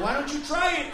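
ordinary talk

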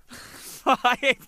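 loud, high laugh; high laughter; burst of laughter. Real, not acted. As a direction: crack up at the memory. Laughing at his own story.

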